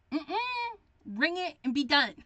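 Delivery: high, loud, curt hurry-up attitude; mock brusque cashier. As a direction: play an impatient cashier for laughs.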